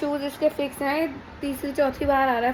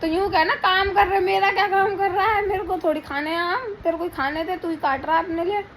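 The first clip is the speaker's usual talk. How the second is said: teasing scold; 5 semitones higher, 3 dB louder than usual. Real, not acted. Teasing husband about cutting mangoes.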